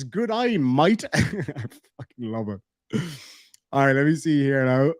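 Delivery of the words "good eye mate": put-on Australian accent. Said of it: "D J says".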